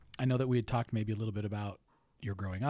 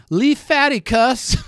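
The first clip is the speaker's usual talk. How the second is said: tickled; 12 semitones higher, 13 dB louder, slower than usual. Real, not acted. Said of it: "that has tickled me so much".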